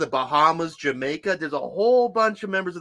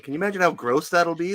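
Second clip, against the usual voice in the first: normal talking voice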